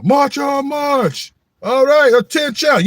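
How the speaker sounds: very high, loud barked marching commands; drill commands. Retold or imitated. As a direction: bark it like a color guard leader. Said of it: "You know, the color guard".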